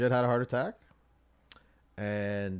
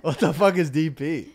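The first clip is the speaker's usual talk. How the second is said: laughing disbelief; 5 semitones higher, 8 dB louder than usual. Real, not acted.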